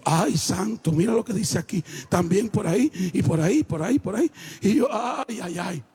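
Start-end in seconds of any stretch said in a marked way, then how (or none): 0.0-4.3 low-pitched muttering over a discovery; retold dismay. Husband studying his wife's symptoms, low retold dismay. 4.9-5.8 low, sinking groan of worry; retold dismay. Husband realising what his wife faces, low dismay.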